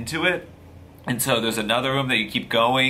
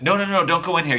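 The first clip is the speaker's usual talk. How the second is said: higher-pitched, rapid-fire no-no-no; acted urgent warning; 6 semitones higher, 4 dB louder, faster than usual. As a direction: imitate someone hurriedly blocking the way. Man blocking her from back room.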